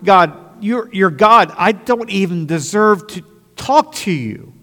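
high, loud, pleading praise to God; fervent loud prayer. Retold or imitated. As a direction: cry out to God with all you have. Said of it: "I come to him in this time and say".

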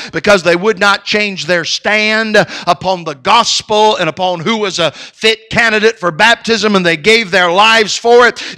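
loud, high, driving sermon delivery; full-force preaching. Acted. Pastor urging church to stand firm, loud high preaching. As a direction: hammer every line from the pulpit. Preacher recounts martyrs, exhorts.

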